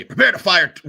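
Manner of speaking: urgent commanding shout; angry captain. Acted. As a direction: order the crew to fire. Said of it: "as you hear him say".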